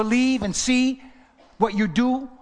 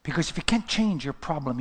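ordinary talk

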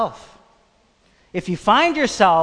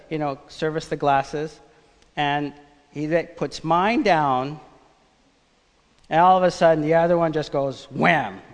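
ordinary talk

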